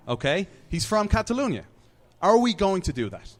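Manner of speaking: ordinary delivery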